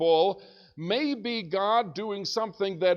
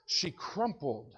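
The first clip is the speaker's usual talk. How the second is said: normal talking voice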